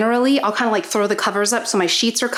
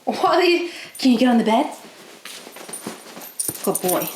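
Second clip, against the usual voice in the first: coaxing dog; 3 semitones higher than usual. Real, not acted. Asking dog onto bed.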